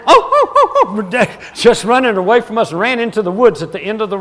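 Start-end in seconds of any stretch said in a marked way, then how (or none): 0.1-1.5 high, slow whimpering yelps, laughing; mock the fleeing dog's frightened yelps; imitating a scared dog. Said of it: "it went".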